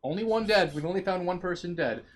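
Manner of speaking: mildly hammed-up headline cry; mock news screaming. Retelling how the news kept reporting it, mock screaming. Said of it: "just screaming".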